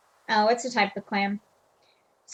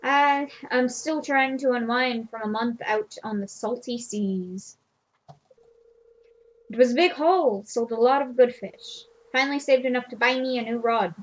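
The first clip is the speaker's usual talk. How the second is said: put-on half-hearted pirate accent. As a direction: lightly put on a salty fisherman accent, barely holding it. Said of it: "I tried to do some kind of accent".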